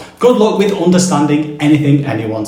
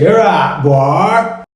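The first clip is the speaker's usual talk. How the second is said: a broad Norfolk greeting called out; Norfolk accent; 3 semitones higher, 3 dB louder, much slower than usual. Shown as a demonstration. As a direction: say it like a Norfolk farmer. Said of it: "Norfolk, people say".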